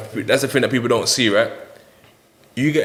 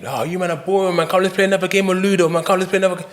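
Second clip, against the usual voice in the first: high-pitched, insistent pleading to keep playing; restless cellmate pestering; 9 semitones higher than usual. Restless cellmate nagging tired men for another game, high insistent pestering. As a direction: imitate a pesky cellmate, pitched well up.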